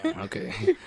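high, soft stunned reaction; a startled, impressed gasp of words; surprised. Retold or imitated. Friend won over by the groom's words, startled awe.

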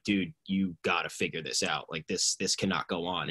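low, hushed talk to oneself; quietly level with yourself; sober self-talk. Retold or imitated. Man facing himself in the mirror, quiet and sober.

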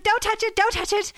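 high, urgent repeated warning; panicked warning. Retold or imitated. Mother warning kids off the baby mouse, panicked. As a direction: imitate a mum stopping the kids in alarm.

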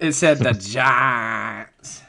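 slowly drawn-out Giants; stretched out. Retold or imitated. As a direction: drag the words out long. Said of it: "you stretch it out".

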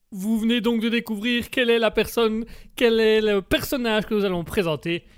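ordinary talk, nothing marked

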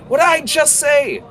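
high, loud fed-up snap; exasperated scold. Acted. Heather scolding Jackal after spraying mead again, loud and fed up. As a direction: scold him like he never listens.

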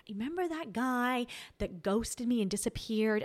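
whispered, hushed and conspiratorial; gossipy whisper. Retold or imitated. Telling her best friend about the ex who ghosted her, in a whisper.

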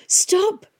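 high winded shout; exhausted boy shouting after the girl. A narrator's reading. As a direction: yell at her to stop. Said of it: "shouted Tom".

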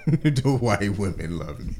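slow, laughing repeat; drawn-out words breaking into a laugh; amused echo. Real, not acted. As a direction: repeat it through real laughter. Laughing echo of the other's boast.

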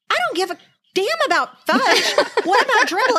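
put-on high, whiny kid voice with a laugh; upset little boy. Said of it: "And Pita's like".

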